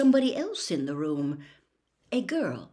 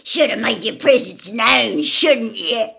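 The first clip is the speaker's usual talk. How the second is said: a louder, hectoring challenge; aggressive; 3 semitones higher, 11 dB louder than usual. Acted. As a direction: push it at him like a threat. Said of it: "said Gaunt aggressively".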